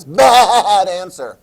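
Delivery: loud, slow, laughing verdict; playful teacher rejecting a silly guess; mock scolding. Shown as a demonstration. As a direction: drag it out like a game-show buzzer. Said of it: "Don't be ridiculous".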